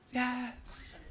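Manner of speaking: soft, breathy whispered yes; breathless yes. Retold or imitated. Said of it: "she's like".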